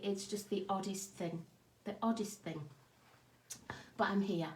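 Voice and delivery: ordinary talk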